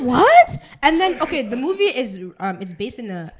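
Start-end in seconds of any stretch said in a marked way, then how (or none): 0.0-0.6 high, loud incredulous cry; exaggerated shocked outburst; mock disbelief. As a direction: blurt it out as if appalled. Retelling stunned reply to teacher.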